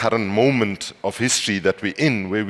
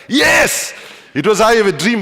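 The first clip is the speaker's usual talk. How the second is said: high, loud imitation of an exasperated heckler; acted-out outraged yell, pitched way up; shouting back; 11 semitones higher, 7 dB louder than usual. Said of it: "she shouted back".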